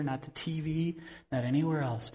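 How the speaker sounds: usual speaking voice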